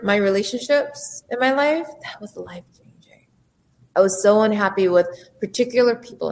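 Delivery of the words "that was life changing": breathy hushed whisper. Real, not acted. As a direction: let it slip out as a whisper. Confessing a big change quietly.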